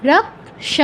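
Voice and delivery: রক্ষ split as rak, then sha; Sanskrit ksha demo. Shown as a demonstration. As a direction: pause after the half k in রক্ষ. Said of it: "ক অর্ধেক".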